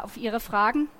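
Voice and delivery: usual speaking voice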